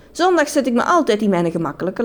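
usual speaking voice

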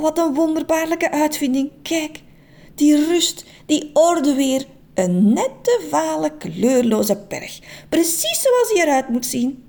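prim little man in raptures; delighted Meneer Just. Acted. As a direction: gush over the neat grey hill. Meneer Just admires colourless hill.

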